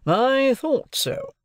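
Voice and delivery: high, drawn-out self-satisfaction; satisfied. A narrator's reading. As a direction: savour being proved right, very slowly. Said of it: "This reply seemed agreeable to Mister Jaggers".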